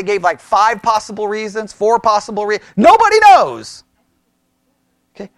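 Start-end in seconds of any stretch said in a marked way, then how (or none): 2.8-3.8 emphatic exclamation. Real, not acted. Exclaims commentaries' confusion.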